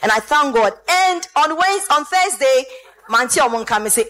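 ordinary delivery

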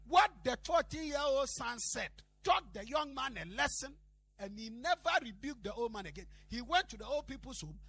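loud, high-pitched preacher's declaration; forceful preaching. Real, not acted. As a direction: drive the lesson home to the congregation. Preaches the lesson at full force.

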